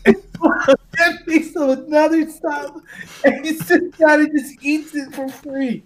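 high-pitched, laughing storytelling; real laughter pushing the voice up high; laughing while talking. Real, not acted. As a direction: crack up while finishing the story. Storyteller cracking up at own story.

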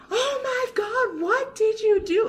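high, shocked gasp of disbelief; mock horror at what he did; shocked villagers. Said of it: "They're like".

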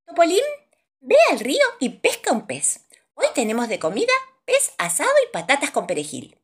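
mother's high, loud call. Acted. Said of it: "mamá Topol le dice a Topolín". (madre llamando con voz aguda)

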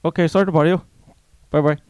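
loud, high put-on Indian accent. Caller backing down and hanging up, loud mock Indian accent. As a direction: sign off in a hammed-up Indian accent. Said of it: "We'll have to do an Indian".

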